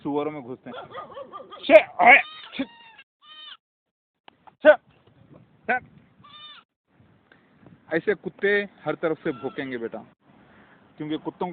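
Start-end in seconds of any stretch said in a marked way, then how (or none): 1.6-5.9 sharp loud high shoo sounds to chase off a dog; loud real shooing hisses. Real, not acted. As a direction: hiss and hush a barking dog away, real. Shooing barking dog away.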